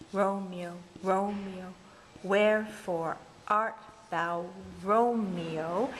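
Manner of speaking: "Romeo" leaning hard on its first syllable; marking the stresses. Shown as a demonstration. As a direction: slowly scan "wherefore art thou Romeo", punching each stressed syllable.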